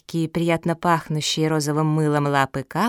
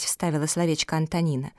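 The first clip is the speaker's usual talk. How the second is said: usual speaking voice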